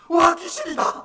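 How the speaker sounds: very high, very loud panicked shout; frightened scream. Acted. Pastor acting disciples scared of a ghost, frightened scream. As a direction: scream in acted terror. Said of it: "하면서 막 소리 지르는 거예요".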